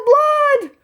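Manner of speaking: very high, loud drawn-out shout; father's yell. A narrator's reading. Father echoes mother's cry of blood, high loud yell. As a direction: holler it high and long. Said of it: "Loretta's father yelled".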